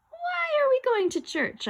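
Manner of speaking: very high, drawn-out question; bewildered question. Acted. Boy questions where ghost takes him, bewildered high voice. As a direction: ask in a slow, high whine.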